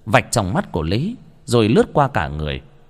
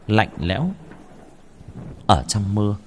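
ordinary delivery, nothing marked

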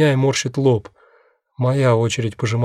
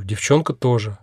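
usual speaking voice

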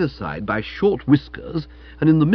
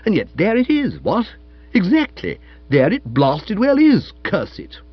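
high, emphatic protest; indignant aunt; 8 semitones higher, 4 dB louder than usual. Acted. Aunt denying to her nephew she upset anyone, indignant. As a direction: protest hotly, sinking to a muttered curse.

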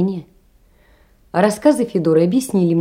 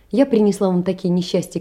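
ordinary talk

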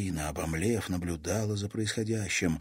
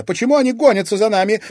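fast, high, loud panic; rapid, alarmed cry; frightened exclamation; 13 semitones higher, 11 dB louder, much faster than usual. A narrator's reading. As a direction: blurt the question in fright. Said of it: "испуганно воскликнула Дельфина".

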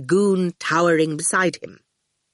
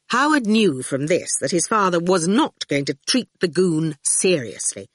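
ordinary delivery, nothing marked

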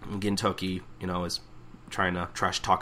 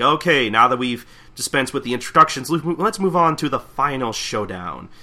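raised, louder announcer voice; 4 semitones higher, 6 dB louder than usual. Acted. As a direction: announce it to the whole crowd. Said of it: "The announcer is like".

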